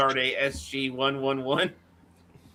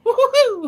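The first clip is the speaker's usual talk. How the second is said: very high, loud hooting laugh; cartoon whoop; 7 dB louder than usual. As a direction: let out a silly high whoop. Cartoonish whoop after movie title.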